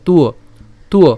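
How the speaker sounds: "তুয়" sounded tu-o; joined sound demo. Shown as a demonstration. Teacher joins ta with the glide, "তুয়" as tu-o. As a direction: say "তুয়" as t run into u-o. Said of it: "তার উচ্চারণ হয়".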